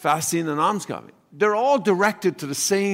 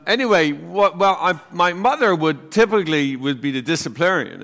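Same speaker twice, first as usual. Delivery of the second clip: usual speaking voice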